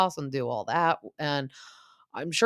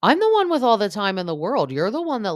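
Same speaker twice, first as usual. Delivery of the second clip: higher and louder, indignant retort; Katie's retort; 7 semitones higher, 6 dB louder, faster than usual. Retold or imitated. Katie snapping back at Lala, higher and louder. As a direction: fire it back like Katie defending herself. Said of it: "Katie's like".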